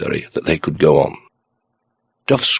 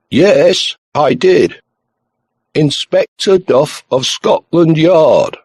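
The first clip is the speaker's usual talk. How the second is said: raised, loud detective announcing himself; shouting; 8 semitones higher, 5 dB louder, much slower than usual. A narrator's reading. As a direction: shout your name down the telephone. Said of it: "realized that he'd been shouting".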